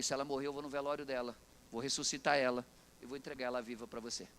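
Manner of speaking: very low, hushed promise; grave quiet resolve. Retold or imitated. Pastor answers the worried husband, grave and quiet. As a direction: drop the voice low and soft, utterly certain.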